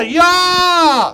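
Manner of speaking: loud high battle cry; viking shout. Shown as a demonstration. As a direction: yell it like a Viking. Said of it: "No, a bit better".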